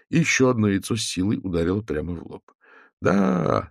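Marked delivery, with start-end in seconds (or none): 3.0-3.7 roaring crowd. A narrator's reading. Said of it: "заревела толпа".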